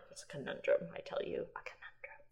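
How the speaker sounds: very soft, whispered aside; mock-confiding murmur. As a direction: let it sink into a playful whisper.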